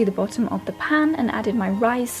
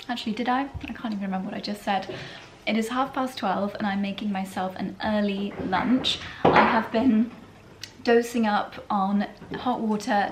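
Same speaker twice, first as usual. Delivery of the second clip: ordinary talk, nothing marked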